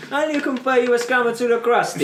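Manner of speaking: high mock Italian accent; mock Italian-American mobster accent; bad Italian accent. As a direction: garble it in a hammed-up Italian accent. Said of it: "his Italian accent's shit".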